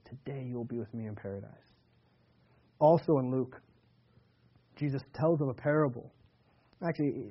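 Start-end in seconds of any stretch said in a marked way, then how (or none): 0.1-1.7 much softer, lower, almost hushed; soft promise. Acted. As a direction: gently reassure him. Jesus answers the repentant thief.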